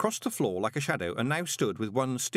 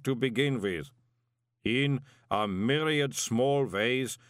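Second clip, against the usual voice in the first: slow, relentless measured indictment; accusing golem; slower than usual. A narrator's reading. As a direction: list the crimes like an implacable clay giant.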